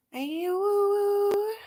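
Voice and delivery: very high, drawn-out howling call; calling stray dogs in the snow with a long high howl; wolf calls. Retold or imitated. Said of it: "all these wolf calls".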